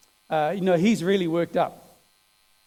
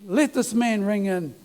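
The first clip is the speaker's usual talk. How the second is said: higher, urgent pleading with God, half-crying; tearful prayer; 4 semitones higher than usual. Retold or imitated. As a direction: cry out to the Lord to speak to him. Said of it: "he's even crying".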